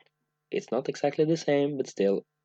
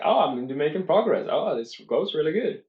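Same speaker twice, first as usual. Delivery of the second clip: mock delight; 5 semitones higher, 3 dB louder than usual. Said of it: "you will feel like".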